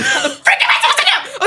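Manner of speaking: mock exasperated outburst. Student reacting to hard test question, fast exasperated laugh.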